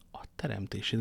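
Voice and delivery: whispered private exclamation; hushed curse under the breath; startled inward thought. A narrator's reading. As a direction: breathe the oath silently to yourself. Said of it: "gondolt D'Artagnan".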